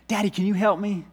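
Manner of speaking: put-on high little-boy plea; lightly voiced child begging dad; pleading kid. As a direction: ask for help like a small child. Son pleading for help.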